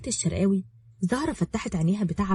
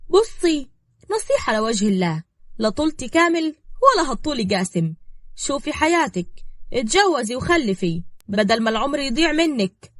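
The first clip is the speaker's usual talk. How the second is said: higher, insistent persuading voice; scheming woman; 6 semitones higher, 4 dB louder than usual. Acted. Woman advising girl to remarry, slyly insistent. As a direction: press the self-serving advice on her.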